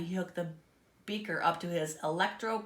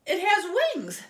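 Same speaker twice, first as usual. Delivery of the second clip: high, loud cry of dismay; 12 semitones higher, 6 dB louder, slower than usual. A narrator's reading. Pig seeing his creation has wings, alarmed cry. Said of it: "he cried".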